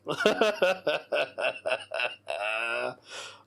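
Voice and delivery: breathy giggling fit; helpless laughter. Real, not acted. Host still laughing with tears. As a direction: keep laughing, can't stop. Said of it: "I'm laughing that much".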